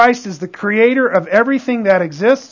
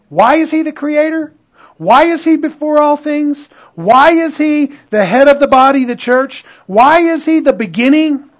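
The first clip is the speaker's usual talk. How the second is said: higher-pitched volley of rhetorical questions; insistent preaching; 5 semitones higher than usual. Real, not acted. Preacher asking the congregation why Christ is supreme, insistent and raised. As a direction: press each question on the congregation.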